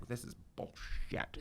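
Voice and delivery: very quiet, slow mock muttering; hushed mock grumble, barely voiced; imitated grumble. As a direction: say it under your breath, drawn out, imitating him. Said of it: "You're like".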